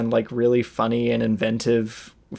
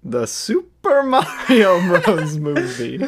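high, slow nasal Luigi attempt; Charlie Day impression; 5 semitones higher, 3 dB louder, slower than usual. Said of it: "almost a Charlie Day impression".

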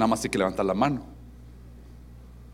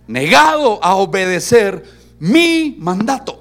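slow, emphatic reading with loud, high peaks; solemn divine pronouncement; 4 semitones higher, 6 dB louder, much slower than usual. Acted. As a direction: proclaim God's lament word by word, forcefully. Lee solemne las palabras de Dios. (proclamación solemne y lenta)

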